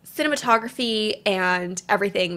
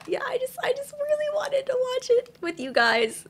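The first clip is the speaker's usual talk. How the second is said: choked up, real emotion breaking through; teary. Real, not acted. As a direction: voice cracking high, on the edge of tears. Overwhelmed, apologises after.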